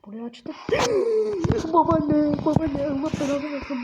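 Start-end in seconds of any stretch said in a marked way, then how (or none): 0.6-1.7 sharp high gasp, much louder; terrified gasp. Real, not acted. The grandmother jumps out at him, terrified gasp. 1.7-3.8 panicked shout, much louder and higher; frightened yell. Real, not acted. Cursing the grandmother who scared him, frightened yell.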